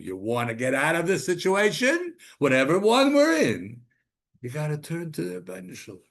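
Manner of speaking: high, loud cries of being stuck; fervent preaching. Acted. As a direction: preach the trap with urgency. Preaching at full force.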